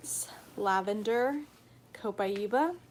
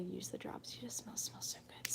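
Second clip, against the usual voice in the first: soft unvoiced whisper; 2 semitones lower, 13 dB quieter than usual. Real, not acted. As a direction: breathe it like a secret. Drifts into whisper describing scent.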